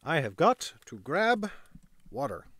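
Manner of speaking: parched. Real, not acted. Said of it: "I'm dying".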